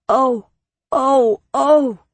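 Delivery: high dismayed cries; rising string of lightly acted ohs; crying out. A narrator's reading. Citizens hearing of the lost children, crying out. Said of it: "cried the citizens of the free cities".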